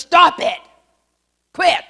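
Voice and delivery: sharp, loud order to stop; stern rebuke. Retold or imitated. Old man rebuffing the preacher, loud and stern. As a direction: snap at him to stop touching you.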